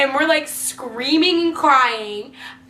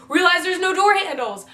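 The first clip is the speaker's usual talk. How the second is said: ordinary talk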